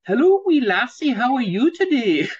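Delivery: cheery high-pitched Scottish lilt; Scottish accent. Retold or imitated. Teacher imitating a Scotsman greeting a girl, Scottish accent. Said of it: "So they might say to you".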